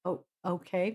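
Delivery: ordinary talk, nothing marked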